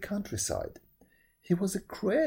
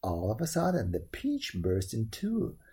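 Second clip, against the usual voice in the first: plain reading voice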